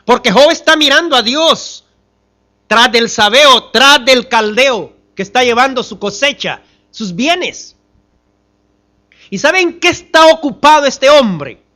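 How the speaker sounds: high, loud pulpit voice; impassioned preaching. Real, not acted. Preacher asks congregation what Job is occupied with, impassioned. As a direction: preach it out with rising force.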